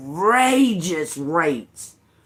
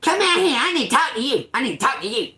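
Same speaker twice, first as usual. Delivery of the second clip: high, urgent mocking imitation; mimicked demanding neighbour; 8 semitones higher, faster than usual. Neighbour demanding a talk at the door, high mocking imitation. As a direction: mimic her pestering in a raised, insistent voice. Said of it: "And she was like".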